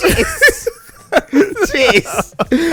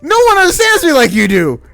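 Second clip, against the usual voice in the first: high, loud mock-romantic plea; hammed-up romance line; 12 semitones higher, 9 dB louder, faster than usual. Host mocking a movie lover's plea. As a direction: overplay the lovesick hero.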